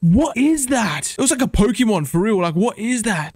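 high-pitched bewildered exclamation; startled disbelief. Real, not acted. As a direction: exclaim it high, baffled by the sight. Startled by strange creature.

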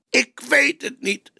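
choked cry. A narrator's reading. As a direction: force it out half-choked. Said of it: "riep hij gesmoord".

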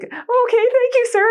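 very high, squeaky imitation of crying; played-up bawling voice; mock tearful. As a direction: answer the officer through put-on tears. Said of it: "I'm still bawling".

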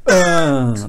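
loud, nasty-tempered groan; big disgruntled "uh"; grumpy groan. Acted. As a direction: groan like a grumbler. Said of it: "a bit nasty and he groans".

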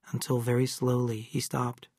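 ordinary delivery

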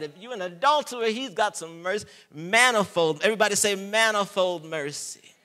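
high, loud, fervent sermon voice; fervent preaching. Acted. Preacher driving home manifold mercy, fervent and loud. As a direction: drive the point home.

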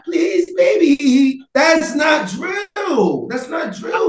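high, slow and loud; emphatic shout. Real, not acted. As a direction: hammer it home loudly. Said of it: "heated insistence".

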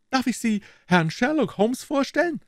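very high character voice; police detective making introductions. Acted. Detective introduces a colleague to Holmes, high voice. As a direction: introduce him in a high put-on voice.

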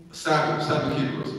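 ordinary talk

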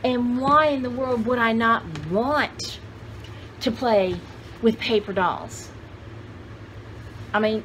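normal talking voice

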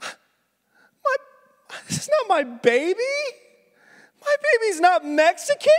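high, loud, tearful panic; panicked crying mother. Acted. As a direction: wail it like a frantic mother. Mother realizes baby swapped.

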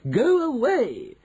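very high, drawn-out command; high, haughty outrage; indignant dismissal. Acted. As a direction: shoo her off slowly and shrilly. Mrs Jenkins dismisses grandmother.

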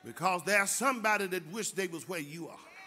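loud, high, fervent preaching shout; preaching at full force. Acted. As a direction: drive it home to the congregation. Preacher exhorts the congregation.

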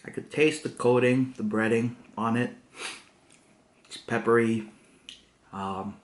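chewing between words; talking while chewing. Real, not acted. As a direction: mouth partly full of food. Seen 0: holds bitten chicken piece; closed-lip chewing motions between words.

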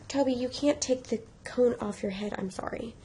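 quiet, gentle no to a pet; soft aside. Real, not acted. Telling Toby the cone stays on, soft aside.

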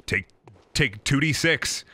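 ordinary delivery

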